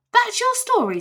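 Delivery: high, loud disbelief; boy aghast at the giant's story; Jack. A narrator's reading. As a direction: shriek it in disbelief. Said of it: "said Jack".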